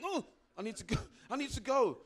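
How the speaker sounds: suddenly realizing you need the loo, urgent. Retold or imitated. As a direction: squeal that you need to go now. Sudden urge to use loo.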